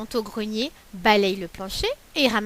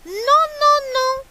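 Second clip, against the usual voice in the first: much higher and louder no; shrill refusal; 13 semitones higher, 6 dB louder, much slower than usual. Acted. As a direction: squeal a refusal. Galette refuses wolf.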